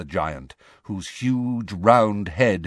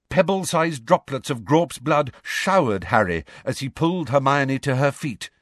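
ordinary delivery, nothing marked

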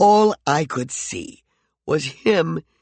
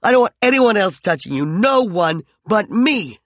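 higher, louder jealous rage; raging; 7 semitones higher, 5 dB louder than usual. Acted. McCoy rages jealously at Peregrine, high and loud. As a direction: rage at her possessively. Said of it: "he raged".

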